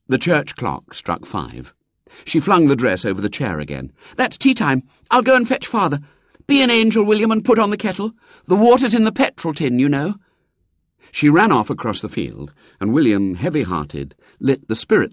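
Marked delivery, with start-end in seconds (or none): 4.1-10.1 put-on high eager girl; a poor girl hoping; little girl voice. A narrator's reading.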